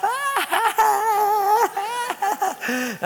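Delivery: high-pitched cackle mimicking a prankster; imitated mocking laugh. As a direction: cackle like a gloating practical joker. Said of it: "watching me with my papers and he'd laugh".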